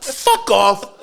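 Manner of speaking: high, drawn-out brush-off; mock dismissal. Ma's look to her son, mock dismissive. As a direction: imitate a scornful look put into words. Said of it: "It's like".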